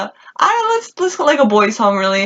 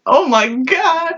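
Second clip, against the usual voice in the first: slow, higher-pitched mock dismay; retold exasperation; 7 semitones higher, much slower than usual. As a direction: drag it out, acting exasperated. Retold reaction to turtle fiasco.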